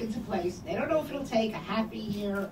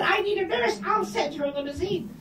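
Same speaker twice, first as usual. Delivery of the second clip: sharply higher, emphatic comeback; exasperated retort; 9 semitones higher than usual. Retold or imitated. Answering the doubtful phone lady, higher and exasperated. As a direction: insist over her doubt.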